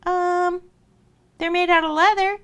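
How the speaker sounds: put-on high little girl voice; sheepish little girl. Little girl explaining her shoes to Mama Wolf, sheepish. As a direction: answer high and hesitant, like a child. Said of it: "little girl was like".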